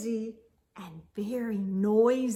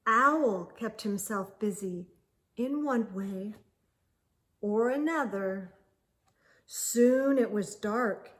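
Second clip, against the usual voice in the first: usual speaking voice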